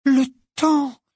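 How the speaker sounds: stunned, high-pitched dawning realization; Hermione effarée. A narrator's reading. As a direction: say it aghast, pitched well up. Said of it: "effarée". (effarée, d'une voix aiguë)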